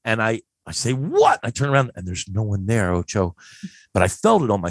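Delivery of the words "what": high, irritated teenage yelp, retold; mad. Teen tapped on the shoulder at the sink, mad. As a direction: throw it out high, acting annoyed. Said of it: "So I'm all mad".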